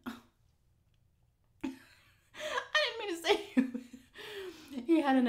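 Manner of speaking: laughing through the words; giggling, can barely get it out. Real, not acted. Laughing at her own slip.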